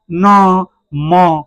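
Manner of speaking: न and म held long through the nose; nasal sound demo. Shown as a demonstration. Teacher showing nose resonance, long nasal न, म. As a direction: hum न, then म from inside the nose. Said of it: "नाक में ना अंदर की ओर से".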